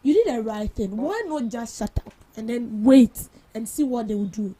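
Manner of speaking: ordinary talk, nothing marked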